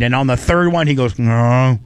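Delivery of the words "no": rising mock bear growl of no's; imitating the bear. Imitating the bear losing its treat, mock growls. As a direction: ham up the bear refusing. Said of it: "this is the sound he made".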